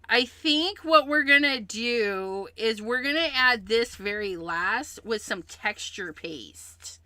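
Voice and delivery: ordinary talk, nothing marked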